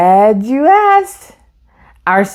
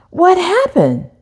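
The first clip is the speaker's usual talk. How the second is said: much higher, worried question; concerned mom; 10 semitones higher than usual. A narrator's reading. Mom asking Isaac what happened at school, concerned. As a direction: ask it like a caring mother. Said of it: "Mom asked".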